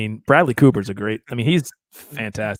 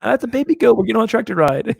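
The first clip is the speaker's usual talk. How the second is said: meek henpecked husband; 8 semitones higher, 4 dB louder than usual. Retold or imitated. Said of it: "He's like".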